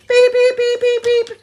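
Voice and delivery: high loud beeps; imitated beeps. Teller mimicking the monitor with high loud beeps. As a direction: mimic the beeping machine, pitched high.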